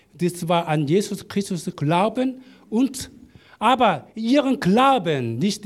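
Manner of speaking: ordinary talk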